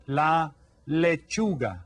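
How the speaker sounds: "lechuga" slowly, le-CHU-ga; slow model word. Shown as a demonstration. Teacher slowly modelling la lechuga for kids. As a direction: draw out "la lechuga" for the class. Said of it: "this is called".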